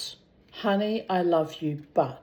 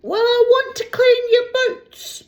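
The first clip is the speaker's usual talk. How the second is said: much higher, louder falsetto wife voice; wife calling offstage; 6 dB louder than usual. Acted. Wife calling to Fred in the bath, high falsetto call. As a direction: call out to him from the next room, high and loud. Marie off.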